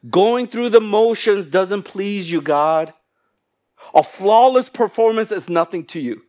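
high, loud preaching delivery; forceful scripture proclamation. Real, not acted. Preacher reading the Message verse to church, forceful proclamation. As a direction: proclaim it to God with full force.

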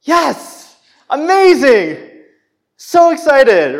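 high, loud, drawn-out glee; overjoyed cheer, hammed up; mock elation. Preacher acting absurdly pleased at being corrected, mock elation. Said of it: "was excitement".

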